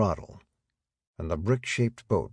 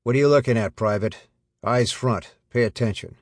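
put-on flat Midwestern accent; 4 semitones higher, 6 dB louder than usual. A narrator's reading. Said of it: "in his flat Midwestern accent said".

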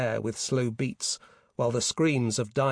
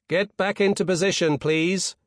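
raised, firm command voice; commanding order; 7 semitones higher, 5 dB louder than usual. A narrator's reading. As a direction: order it out over a loudhailer, louder and higher. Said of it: "he ordered".

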